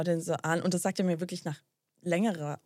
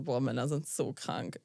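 low, slow, disgusted "Boah, Männer sind so krank", drawn out; 6 semitones lower, 6 dB quieter than usual. Retold or imitated. Telling her friend her reaction to the man, low and disgusted. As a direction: let the disgust sink the words low and slow. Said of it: "Ich so, wirklich original".

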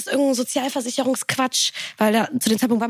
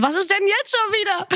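exasperated; 11 semitones higher than usual. Retold or imitated. Annoyed reply to sister.

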